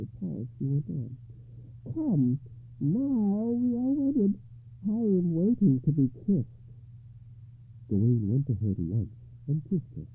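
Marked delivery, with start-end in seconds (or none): 1.9-6.7 coaxing lady voice. Acted. Lady invites a kiss.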